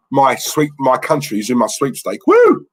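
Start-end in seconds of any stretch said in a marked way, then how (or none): 2.3-2.6 high, loud whoop of joy; excited whoop. Real, not acted. Cheering on his sweepstake team, loud high whoop. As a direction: cheer it out at full tilt.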